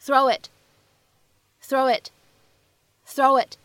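"throw it" with a small w glide joining the words, throw-wit; linked throw it. Shown as a demonstration. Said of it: "此时我们可以读成".

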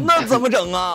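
put-on panicked kid. Kid panicking about beating.

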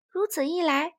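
ordinary delivery

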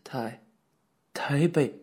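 trembling voice. A narrator's reading. Wu Min gives in to the officer, trembling. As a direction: falter on the first syllable, then force it out. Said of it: "吴敏的声音颤抖起来".

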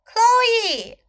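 very high, loud repeated call. A narrator's reading. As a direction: call out eagerly for your friend. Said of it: "she called".

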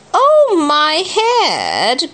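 high, drawn-out mock groan; stretched, high-pitched complaint; Kipper's groan. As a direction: slowly moan as a hurt storybook character. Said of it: "groaned Kipper".

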